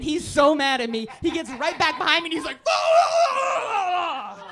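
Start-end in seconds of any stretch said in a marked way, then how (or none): 2.6-4.4 very high, long howled curse; imitated furious scream. Comic voicing furious tailgater, wailing scream. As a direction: howl like an enraged driver. Retold furious driver behind.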